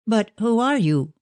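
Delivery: put-on a mild alarmed cry of a frightened girl. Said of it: "cried Ruth".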